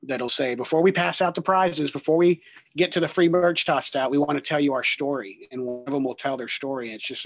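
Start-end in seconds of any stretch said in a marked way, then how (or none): 0.5-5.5 loud event announcer voice; announcer call. Retold or imitated. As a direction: call it out to the crowd. Quoting announcer at youth event.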